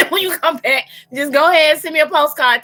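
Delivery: high, laughing send-off; giggly mocking goodbye. Real, not acted. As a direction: tease them through a laugh. Mock sending off a traveler.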